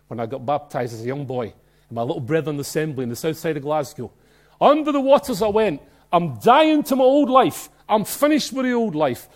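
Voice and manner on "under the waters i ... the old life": raised, louder, slowed declaration; fervent testimony. Retold or imitated. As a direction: proclaim it with full conviction. Preacher voicing baptism candidate.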